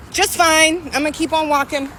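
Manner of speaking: sweet high voice, brushing him off; high and overly polite, trying to get away; fake-cheerful high brush-off to a stranger. Brushing off a catcaller.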